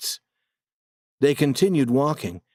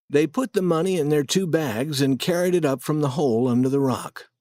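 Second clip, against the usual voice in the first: usual speaking voice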